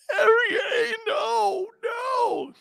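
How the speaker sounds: very high, wailing no, no; desperate crying plea. Retold or imitated. Cut player wailing to his coach. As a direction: beg like a kid who just lost everything.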